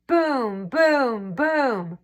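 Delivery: evenly spaced booming knocks; door knocking. A narrator's reading. Narrator knocking again on the dragon's door, booming. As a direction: knock three times, steady. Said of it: "banged on the door".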